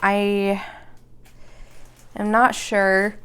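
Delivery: words stretched through a yawn. Real, not acted. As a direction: say it mid-yawn, slowly. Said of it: "Sorry, I'm yawning".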